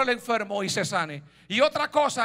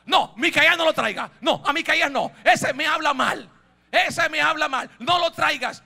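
higher, insistent refusal; acted protest; 4 semitones higher, 7 dB louder than usual. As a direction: play a king firmly refusing. Rey se niega a traer a Micaías. (negativa insistente y actuada)

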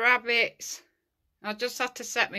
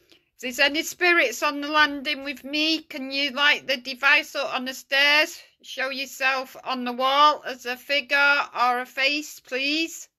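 raised, higher voice addressing spirits; calling out; 5 semitones higher, 5 dB louder than usual. Real, not acted. Ghost hunter to spirits on the landing, calling out. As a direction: call out a polite request to the unseen.

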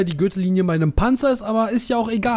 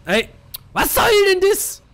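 high indignant outcry, far higher; outraged surprise; 3 dB louder than usual. Real, not acted. Gamer gets shot out of nowhere, high indignant outcry. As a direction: yelp it in disbelief.

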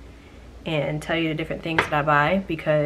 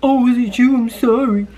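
mocking shopper; 5 semitones higher, 7 dB louder than usual. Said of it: "He talking about something".

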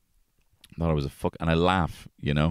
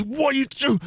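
high, loud shocked shout; alarmed yell; 3 dB louder than usual. Retold or imitated. Dad catching son hurting sister, high loud yell. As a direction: bark the question in alarm. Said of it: "And then I was".

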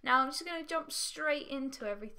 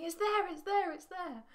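high, mildly raised mock yell; imitated screaming viewers; 7 semitones higher than usual. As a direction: imitate viewers yelling at the screen. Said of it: "probably sat there screaming at me".

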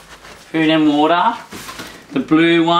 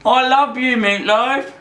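affectionate coo; 6 semitones higher than usual. Real, not acted. Cooing to new puppy.

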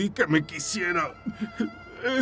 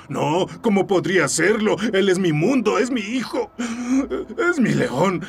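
louder, voice cracking with sobs; crying; 4 semitones higher, 3 dB louder than usual. Acted. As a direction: sob out how much he loves him. Breaks down over his son.

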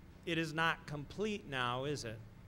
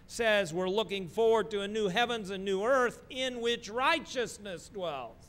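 soaring preacher's voice, higher and louder; 7 semitones higher, 8 dB louder than usual. Acted. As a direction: build to a joyful climax. Preaching builds to climax.

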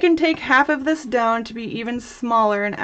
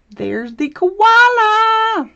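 delighted reveal; 11 semitones higher, 7 dB louder, much slower than usual. Real, not acted. Reveal of finished koala cane.

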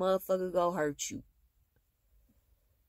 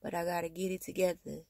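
tender and soft; 9 dB quieter than usual. Real, not acted. Tender words to her kids.

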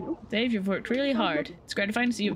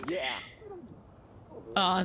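normal talking voice

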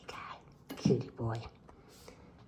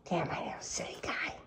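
fast whispered coo; fast whispered pet talk; 10 dB quieter, much faster than usual. Real, not acted. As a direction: whisper it quickly and sweetly.

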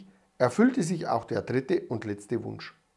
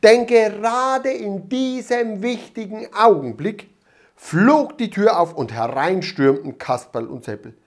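usual speaking voice

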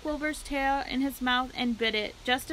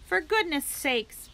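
bellowed; 6 semitones higher, 3 dB louder, slower than usual. A narrator's reading. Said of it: "bellowed Mister Zuckerman".

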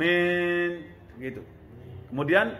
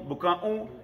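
ordinary talk, nothing marked

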